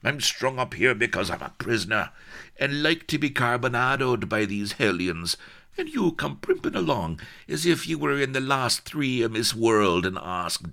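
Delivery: high, forceful, aggrieved tirade; urgent, irate complaint; exasperated rant. Acted. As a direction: berate her while in pain. Hanging prisoner rants at her.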